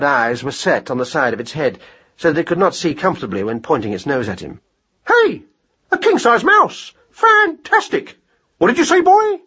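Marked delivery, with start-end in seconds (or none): 5.1-9.4 very high, brash hare voice; excitable mad hare. Acted. Hare hails a giant mouse, excitable and high. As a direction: exclaim at a surprising find, pitched high.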